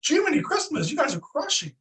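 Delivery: high, goofy astonishment; mock amazement. Praising colleagues who are crushing, in high mock amazement. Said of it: "And I was like".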